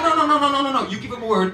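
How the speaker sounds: rapid, pleading string of no; frantic protest. Retold or imitated. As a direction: talk fast and eager to win her back.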